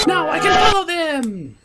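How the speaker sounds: high, triumphant gloating; gleeful high voice. Acted. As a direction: crow over the victory. Villain gloats.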